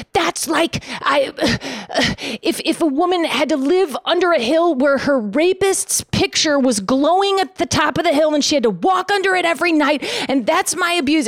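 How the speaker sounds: mock-dramatic complaint about the cross; mock outraged rant. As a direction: ham up your old self's outrage. Mock outrage retold quote.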